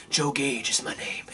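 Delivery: soft, slow, whispered quote of the liar; sly whisper. Retold or imitated. Podcaster imitating Joe Gage's shady introduction, whispered. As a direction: hush it like a shady confession. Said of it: "you're one of them from the first thing".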